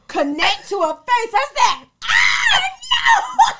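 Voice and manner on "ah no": very high, loud drawn-out scream; mock scream. Retelling a punch at the party, high mock scream. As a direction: scream it out, playing up the shock. Retelling a sudden fist fight.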